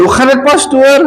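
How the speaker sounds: playful churchgoer voice; mock greeting. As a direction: lightly imitate a congregant greeting the pastor.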